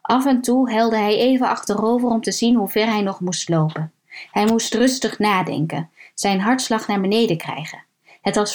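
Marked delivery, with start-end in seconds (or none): none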